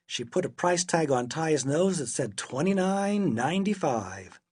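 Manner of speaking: usual speaking voice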